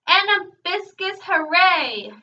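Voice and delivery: loud, high-pitched cheering; shouted cheer. A narrator's reading. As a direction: shout your support to the singer. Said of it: "shouted Double".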